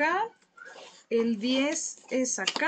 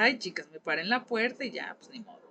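ordinary talk

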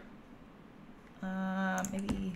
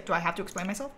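ordinary talk, nothing marked

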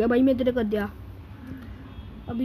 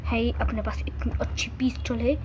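normal talking voice

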